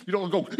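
a high squeal of fright, hammed up; mock shriek. Preacher mimics a person jumping at an angel with a high yelp. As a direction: play the startled householder with a silly high yelp. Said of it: "if an angel suddenly was in your house".

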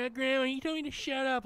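put-on high-pitched tattling child voice; exaggerated high kid impression; mimicking a kid. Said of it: "And they say".

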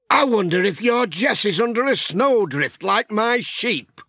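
musing, a touch of worry for his sheep; farmer Alf. A narrator's reading. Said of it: "said Alf".

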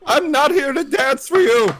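high, loud put-on outburst; hammed-up refusal to perform; mock protest. Mock protest at impression requests.

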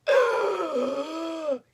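sharp, loud, high gasp; starstruck gasp. Retold or imitated. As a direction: gasp like your idol just spoke to you. Starstruck reaction to Mark.

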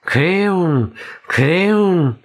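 high, drawn-out call of a name; Ausruf, calling out. A narrator's reading. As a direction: call out with pitch arching up and falling. (rufend, hoch und gedehnt)

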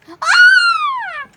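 long high shriek; sudden loud scream of fright; startled scream. Real, not acted. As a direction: scream out in shock.

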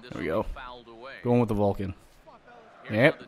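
ordinary talk, nothing marked

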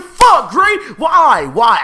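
high, loud anguished yell; frustrated shout. Retold or imitated. Fan yelling at Gray for copying Sasuke, anguished shout. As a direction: shout it like you can't believe it. Voicing his own reaction to Gray.

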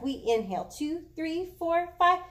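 counting out each breath slowly. Shown as a demonstration. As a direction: count the inhale steadily for the class. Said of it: "We inhale for five, exhale for five".

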